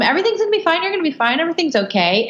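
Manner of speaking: high-pitched, hurried reassurance, easing down; sing-song parental reassurance, starting high; mock soothing parent. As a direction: placate a crying child in a high voice. Voicing parents hushing a crying kid.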